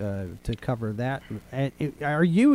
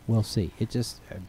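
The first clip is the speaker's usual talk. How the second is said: usual speaking voice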